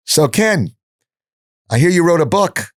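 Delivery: the wife's curious question at dinner, mildly voiced; rabbi's wife. At a Shabbat dinner, the rabbi's wife asks Ken, lightly voiced. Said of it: "the rabbi's wife goes".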